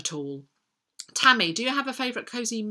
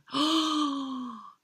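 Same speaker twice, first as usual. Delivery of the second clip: long, high gasp of delight; adoring gasp; 8 semitones higher than usual. Real, not acted. Reader remembering a beloved childhood author, delighted gasp. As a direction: gasp in real rapture.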